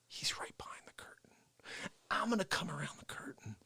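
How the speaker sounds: unvoiced sneaking whisper. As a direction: creep up and hush it. Sneaking up on Face.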